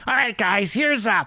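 put-on slideshow host, pitched high. Imagining a slideshow of a bathroom paint job, put-on presenter voice. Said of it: "I think you're doing your A J W voice".

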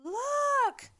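very high, drawn-out mock holler; hammed-up high-pitched call; imitating an excited call. Host mimics Michelle spotting a policeman, hammed-up excited call. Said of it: "Michelle goes".

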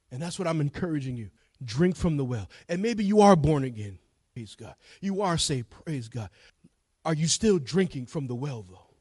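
normal talking voice

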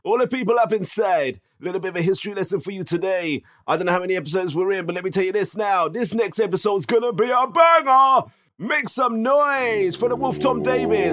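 loud, high hype MC; big show intro announcer. Pretend.

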